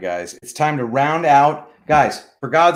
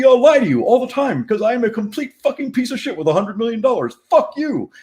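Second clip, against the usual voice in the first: higher, put-on voice of a smug rich boomer; Scott Adams impression; 5 semitones higher than usual. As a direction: play a lying rich jerk, rising to a higher yell at the end. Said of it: "we need toilet brush impersonation".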